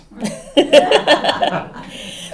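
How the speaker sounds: short, high real laugh; a quick chuckle at your own joke; light laugh. Real, not acted.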